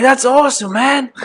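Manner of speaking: very high mock London accent; put-on London accent. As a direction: pitch it way up as an imitated Londoner. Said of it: "You gotta hit him with the accent".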